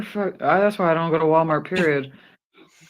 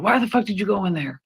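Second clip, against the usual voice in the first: fast, higher exasperated question; mock outraged; 6 semitones higher, faster than usual. Wife scolding husband about Walmart, fast and outraged. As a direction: fire it at him, incredulous. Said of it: "I'm like".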